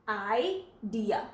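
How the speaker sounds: "आइडिया" slowly, opening on "eye"; long vowel I. Shown as a demonstration. Teacher applying the open syllable rule, long I. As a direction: draw out "आइडिया" as eye, then dia. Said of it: "आप इस vowel का नाम पढ़ेंगे".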